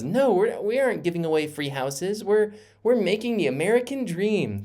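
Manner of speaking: high, sing-song mocking politician voice; high mocking imitation of a politician; Nancy Pelosi impression. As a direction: lampoon her, pitched well up in a put-on voice. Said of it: "That's my Nancy Pelosi impression".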